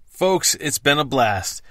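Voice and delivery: usual speaking voice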